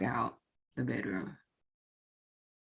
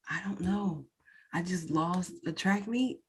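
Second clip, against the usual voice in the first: ordinary talk, nothing marked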